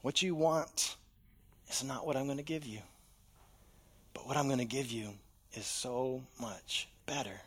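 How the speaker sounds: God answering a frustrated believer gently; gentle God. Acted. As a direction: reassure like a patient father. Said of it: "God answers so gently".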